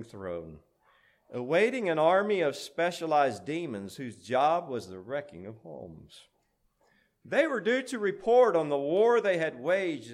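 grand storyteller, rolling with the meter; dramatic verse recitation. A narrator's reading. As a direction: recite it like an epic poem.

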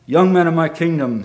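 booming king's announcement; royal proclamation. Acted. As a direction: call out to the whole courtyard. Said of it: "said King Akbar".

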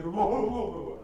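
loud, high barks falling away at the end; imitated dog barking. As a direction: bark repeatedly like a lunging dog. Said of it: "like that".

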